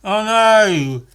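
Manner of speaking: sarcastic fake panic; mock surprise at the cow; mock alarm. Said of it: "I'd say".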